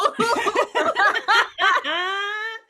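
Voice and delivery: loud, high burst of laughter; high shrieking laugh. Real, not acted. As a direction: crack up loud at the joke. Big laugh at the note.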